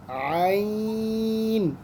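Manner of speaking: आइन held very long from the throat; long throaty ain. Shown as a demonstration. Teacher demonstrating आइन to pupils. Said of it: "difference pronunciation का".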